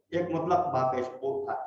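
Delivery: ordinary talk, nothing marked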